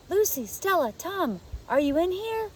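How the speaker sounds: put-on a child urgently searching the dark room; urgent child whisper. A narrator's reading. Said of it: "A child's urgent whisper".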